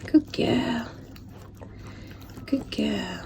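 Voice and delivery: soft whisper to the puppy; whispered praise. Real, not acted. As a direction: hush it so she keeps eating. Softly praising eating puppy.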